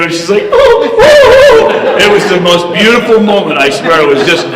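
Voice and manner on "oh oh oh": high, loud, drawn-out "oh"s; mock embarrassed wail, pitched way up; hammed-up embarrassed howl; imitated mortified cry. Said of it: "she turned beet red as a tomato".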